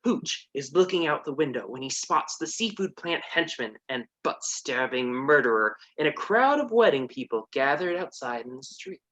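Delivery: ordinary talk, nothing marked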